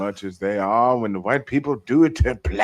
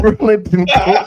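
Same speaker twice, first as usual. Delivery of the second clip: loud, higher, laughing mispronunciation; 8 semitones higher, 7 dB louder than usual. Real, not acted. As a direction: blurt the wrong name through a laugh. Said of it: "mangling the author's name, laughing".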